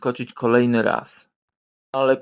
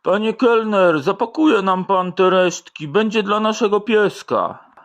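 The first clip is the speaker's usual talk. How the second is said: calling the waiter; 6 semitones higher, 3 dB louder than usual. Acted. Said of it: "Janusz krzyczy do kelnera".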